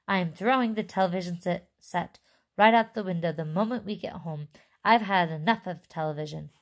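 usual speaking voice

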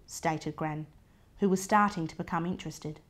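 plain reading voice

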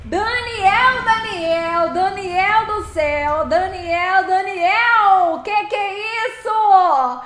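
loud, drawn-out calls of Daniel; excited calling out. Real, not acted. Thrilled streamer calling out to donor Daniel, loud and drawn out. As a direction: shout his name over and over, thrilled.

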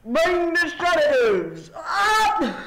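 hammed-up frantic order; urgent shout. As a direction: call for sedatives in alarm. Nurse shouts for sedatives.

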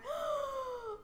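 sharp high gasp of delight; excited gasp. Real, not acted. First look at the new town, a delighted gasp. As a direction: gasp at the sight.

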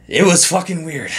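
high, laughing words. Real, not acted. Vlogger to viewers, laughing at how weird it was. Laughing about the strange trip.

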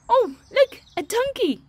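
high, loud excited discovery; delighted surprise. Acted. Teacher shows children a new animal, excited. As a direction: burst out as if just spotting it.

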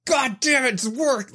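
high-pitched, exasperated mock rant; strained rage, pitch up without full volume; retold yelling. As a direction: re-enact losing it at an automated line. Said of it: "just yelling at the top of my lungs".

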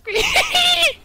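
high, loud laughing shriek; gleeful squeal. Real, not acted. Squeals after being called kid.

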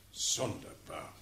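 low, slow, soft murmur; musing to himself. Acted. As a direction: ponder it quietly aloud. Musing to himself after reading.

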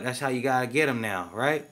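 usual speaking voice

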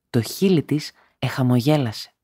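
ordinary delivery

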